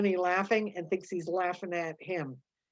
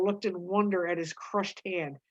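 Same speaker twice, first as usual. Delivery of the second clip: ordinary delivery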